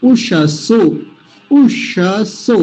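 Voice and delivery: उषसो slow, with a retroflex ष; careful recitation. Shown as a demonstration. Teacher showing the retroflex sound to students. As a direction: curl the tongue back on ष in उषसो. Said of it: "मूर्धन्य शकार".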